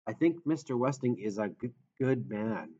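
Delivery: halting stutter on first sounds; stammering. Acted. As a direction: stutter through the words. Chris speaks with stammer.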